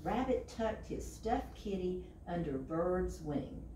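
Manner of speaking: ordinary delivery, nothing marked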